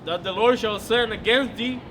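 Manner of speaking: usual speaking voice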